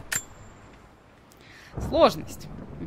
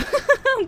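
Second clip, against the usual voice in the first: quick high laugh; a bright burst of laughter; short laugh. Real, not acted. Laughs after teasing.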